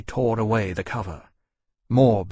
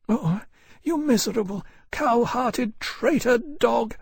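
higher, contemptuous scorn; angry young woman; 13 semitones higher, slower than usual. Acted. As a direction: spit the insult at a traitor. Harcourt insults her captor.

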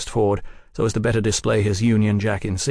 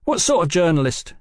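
high, suspicious questioning; pitched-up demanding question; 8 semitones higher, 3 dB louder than usual. A narrator's reading. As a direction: demand an answer, full of suspicion. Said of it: "he demanded".

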